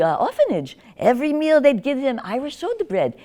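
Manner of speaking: ordinary talk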